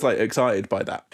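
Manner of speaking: ordinary talk, nothing marked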